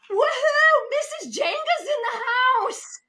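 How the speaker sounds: high, loud whooping cheer; crowd cheer. Retold or imitated. Pool staff greeting the guest, loud whooping cheer. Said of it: "And we would hear".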